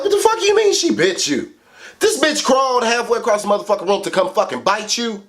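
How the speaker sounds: can't believe what he's hearing; shocked disbelief. Retold or imitated. Kid hearing sister bit twin.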